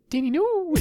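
very high mock-mom falsetto; horrified mom. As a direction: squeak it like a dismayed mother. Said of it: "the whole, the whole".

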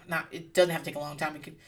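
ordinary talk, nothing marked

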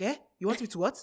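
put-on puzzled dad with a Nigerian accent. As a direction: imitate a baffled Nigerian father. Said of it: "I'm about to use an accent".

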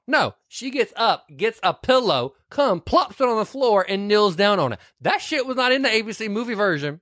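high, animated retelling; excited. Real, not acted. Telling a friend about the real movie, high and excited. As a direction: gush over the uncut scene.

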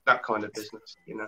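usual speaking voice

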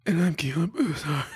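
whiny crying character voice; second voice. Acted. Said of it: "I'm gonna do both my voices".

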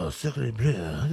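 slow, soft hammed-up French exclamation; mock French accent. Goaded host playing up French for the co-host, hammed-up French accent. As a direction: groan it out in stagey French. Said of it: "now do that when you say that word".

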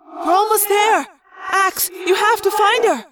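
high, pressing call with echo; urgent plea. A narrator's reading. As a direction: insist that the search must succeed.

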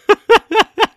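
loud, high-pitched burst of laughter; real high giggling outburst. Real, not acted. Amused telling of sex scene.

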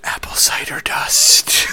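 hushed, reverent whisper. Pretend. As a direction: breathe it like a secret. Dramatic whispered product name.

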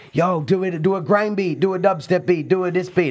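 high pushy street voice; rude boy demand. Retold or imitated. Rude boys on the street demanding beats, high pushy. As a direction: bark requests like a rude boy. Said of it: "rude boys coming up to me going".

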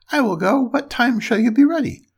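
high piglet voice; eager little pig. A narrator's reading. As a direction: agree and ask in a high storybook pig voice. Pig agrees, asks the time.

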